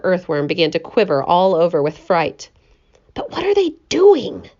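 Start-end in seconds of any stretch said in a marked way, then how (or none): none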